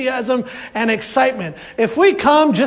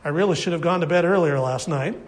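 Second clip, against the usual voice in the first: tired attitude; 6 semitones lower, 5 dB quieter, faster than usual. Retold or imitated. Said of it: "come with the attitude of".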